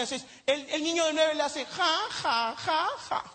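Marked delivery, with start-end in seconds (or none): 1.7-3.2 put-on a kid's flat sarcastic ha-ha; mock fake laugh. Boy mocking his father's joke, fake laughter.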